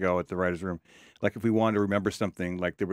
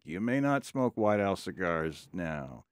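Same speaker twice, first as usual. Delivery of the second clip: slow, drawn-out commercial pitch; old TV cigar pitchman; 4 semitones lower, 3 dB quieter, slower than usual. Retold or imitated. Retelling a seventies cigar ad, slow pitchman voice. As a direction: imitate a measured TV ad spokesman. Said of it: "he going like".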